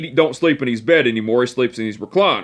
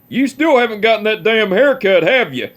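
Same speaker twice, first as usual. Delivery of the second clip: higher, mock-gruff old man scolding; imitating great-grandpa; 7 semitones higher than usual. Great-grandpa teasing his long-haired great-grandson, mock-gruff higher old man voice. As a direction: needle him about his hair like a cranky old man.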